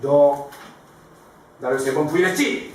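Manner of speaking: hammed-up accusing question; acted-out cornering accusation; mock interrogation. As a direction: press him as a pretend scolding. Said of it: "이러면서 막 다그치지 않았어요".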